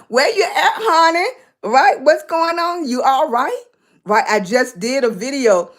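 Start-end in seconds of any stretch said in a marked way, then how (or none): 0.1-3.6 lightly voiced, higher caring grandma; a hint of a fussing grandma asking after family; grandma checking in. Host imitating grandma checking on viewers, lightly higher. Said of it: "I'll be like, "Grandma calling you up".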